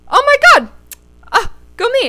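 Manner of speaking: high, loud burst of delight; excited. Real, not acted. Cheering herself for finally confronting her ex, excited. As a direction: squeal as you cheer yourself on. Celebrating her own courage.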